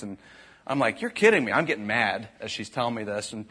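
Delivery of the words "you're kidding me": incredulous. Retold or imitated. Dad reacts to daughter's story.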